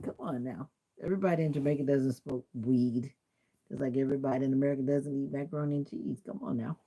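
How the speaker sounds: ordinary talk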